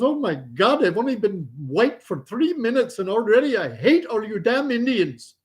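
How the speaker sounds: high-pitched fed-up complaint; exasperated son.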